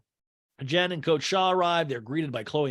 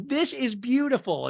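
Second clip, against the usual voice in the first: high, drawn-out compliment; gushing imitation; 10 semitones higher, slower than usual. [name] compliments the event, high mock gushing. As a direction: gush it slowly, pitched way up. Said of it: "N G K goes".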